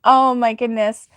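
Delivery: ordinary talk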